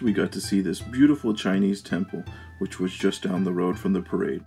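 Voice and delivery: ordinary talk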